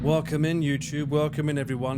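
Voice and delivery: youtube intro. Acted. Intro announcer read.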